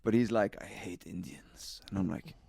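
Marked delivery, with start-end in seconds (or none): 0.6-1.8 hushed mutter. Retold or imitated. Boss's remark retold, very soft.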